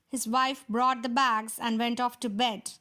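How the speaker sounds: usual speaking voice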